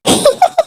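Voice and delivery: put-on high little boy's giggle; a quick delighted child laugh; childish giggle. A narrator's reading. Little chick laughing beside his sleeping mother, high giggle. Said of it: "Kuku जोर से हंसने लगता है".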